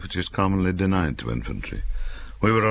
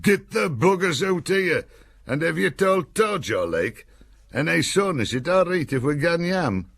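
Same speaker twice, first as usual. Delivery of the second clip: Cumbrian jeering cries; 14 semitones higher than usual. Retold or imitated. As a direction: jeer back at the officer in broad Cumbrian. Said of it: "punctuated by cries of".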